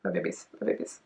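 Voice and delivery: breathy, hushed fan whisper; whispered countdown. Real, not acted. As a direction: whisper it before the video starts.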